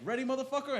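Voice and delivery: a high, hammed-up badass one-liner; taunting heroine. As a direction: sneer it before the kill. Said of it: "she's like".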